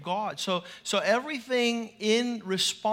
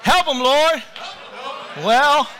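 loud, higher church call-outs; big raised-voice congregational calls; congregation shouts; 5 semitones higher, 9 dB louder, slower than usual. Shown as a demonstration. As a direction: holler the responses to the preacher. Calling out church responses loudly.

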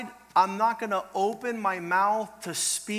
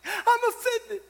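high, loud mock whine; mock the snowflakes; whiny offended people; 5 dB louder than usual. Mocking offended listeners, high whiny voice. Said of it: "they're like".

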